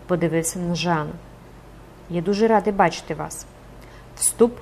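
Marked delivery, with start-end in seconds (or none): none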